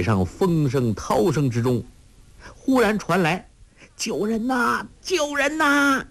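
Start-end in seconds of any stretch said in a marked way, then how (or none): 3.9-6.0 long, high, drawn-out cry for help; desperate distant shout; calling for help. A narrator's reading. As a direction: shout it across the sea, slow and stretched. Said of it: "两声叫喊".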